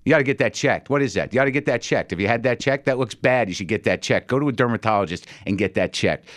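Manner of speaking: ordinary delivery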